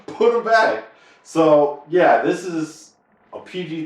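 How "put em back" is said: higher mock yell; mock panicked yell; mock yelling girl. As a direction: repeat the demand like the upset girl. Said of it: "she was yelling".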